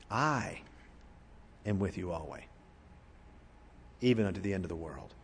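"I" isolated by a long pause, very soft; quiet weight on "I", the rest dropped low; stress on I. Shown as a demonstration. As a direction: say "I" alone, pause, then low and hushed. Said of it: "Emphasize a different word".

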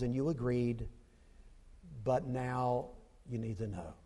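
soft, hushed Father and Son exchange; intimate dialogue. Acted. Preacher imagining Jesus talking with the Father, hushed and tender. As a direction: whisper the conversation as sacred.

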